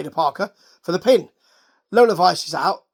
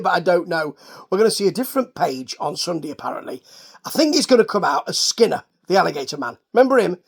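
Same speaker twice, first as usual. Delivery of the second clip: normal talking voice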